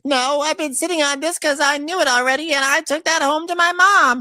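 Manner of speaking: high, loud put-on voice; mock Portia. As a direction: camp it up as the gossiping housewife. Voicing Portia in a quarrel.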